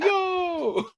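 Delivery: high, far-off-sounding mock whoop; LeBron hollering. LeBron on the private jet, whooping high in mock glee. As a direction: whoop it up like a proud dad. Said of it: "just going like".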